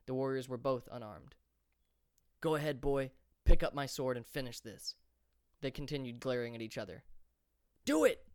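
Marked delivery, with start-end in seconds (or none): 2.4-5.0 louder, daring challenge rising to a shout; defiant challenge. A narrator's reading. Esper daring Turtel to finish him, growing loud. 7.8-8.3 high, very loud scream. A narrator's reading. Esper screaming at Turtel to strike, at full force. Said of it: "he screamed".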